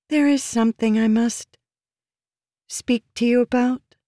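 high, careful feminine voice with hesitant pauses; careful, weak, feminine. A narrator's reading. Woman broaching a delicate matter with the commander, careful and weak. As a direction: sound delicate and high, choosing words carefully. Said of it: "she said carefully, trying to make her voice sound weak and feminine".

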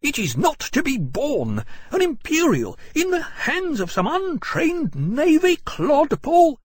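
very high, loud, drawn-out protest; outraged cry. A narrator's reading. Thwarted young officer insulting the navy man, outraged cry. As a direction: cry out in slow, high indignation. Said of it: "he cried".

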